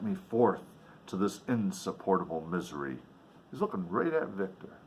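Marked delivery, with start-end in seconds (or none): none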